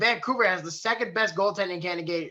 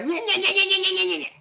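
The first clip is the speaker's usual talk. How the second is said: high-pitched held babble on one note; mocking nyeh-nyeh noise; 13 semitones higher than usual. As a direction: rattle off a high, taunting nyeh-nyeh. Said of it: "and then it's just like".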